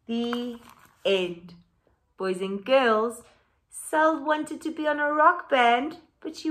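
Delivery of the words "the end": bright, louder storybook reading; animated picture book read-aloud; reading to children. A narrator's reading. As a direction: read it out lively for the class.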